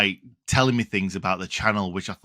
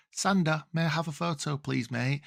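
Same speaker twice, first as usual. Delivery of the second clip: soft, higher-pitched polite ask; timid request; 4 semitones higher, 8 dB quieter than usual. Retold or imitated. Fan asking footballer Sander for a photo, soft and timid. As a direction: ask meekly and softly, a little high. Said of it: "before I got a chance to say".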